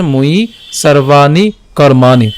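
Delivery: "ময়ি সর্বাণি কর্মাণি" slowly, long vowels drawn out; pronunciation demo. Shown as a demonstration. Teacher models "ময়ি সর্বাণি কর্মাণি" for learners, slow and measured. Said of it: "উচ্চারণ কেমন হচ্ছে".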